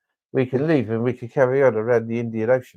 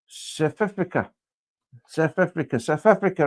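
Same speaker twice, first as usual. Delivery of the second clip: mock South African accent; 5 semitones higher than usual. Friend mocks the place name in South African accent.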